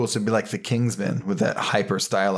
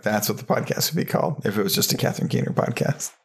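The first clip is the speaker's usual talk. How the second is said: normal talking voice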